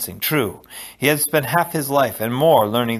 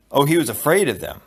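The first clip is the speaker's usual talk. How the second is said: plain reading voice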